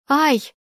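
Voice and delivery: a sudden squeal as she hits the wall; sharp pained squeal; yelp of pain. A narrator's reading. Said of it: "взвизгнула я".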